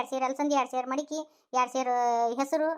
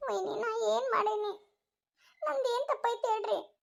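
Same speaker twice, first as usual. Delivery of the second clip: high, crying protest; wounded, sobbing appeal; tearful pleading; 6 semitones higher, 3 dB quieter, slower than usual. Acted. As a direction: plead innocence through tears. Accused woman pleads her innocence.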